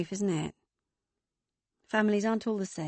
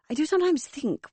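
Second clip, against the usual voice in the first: higher, louder, self-impressed; marvelling; 9 semitones higher, 5 dB louder than usual. Acted. Mother boasting to Megan, higher and louder. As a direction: sound proud of yourself.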